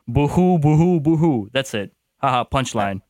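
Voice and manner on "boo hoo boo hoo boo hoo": mock boo-hoo sobbing, louder; hammed-up fake crying. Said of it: "The parent's like".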